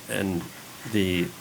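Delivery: ordinary talk, nothing marked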